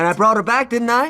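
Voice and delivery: high, loud, challenging; angry shout. Acted. Johnny yelling at Aisha that he saved her sister, angry. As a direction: throw it back in her face. Continuing the quarrel.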